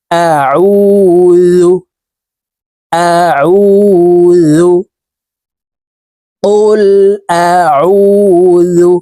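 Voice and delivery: আউযু with ع pressed from the middle of the throat, drawn out; correct Arabic pronunciation. Shown as a demonstration. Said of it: "আইনকে হলকের মধ্যখান থেকে চাপ দিয়ে". (গলার মাঝখান থেকে চাপ দিয়ে আউযু)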